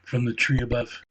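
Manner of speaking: plain reading voice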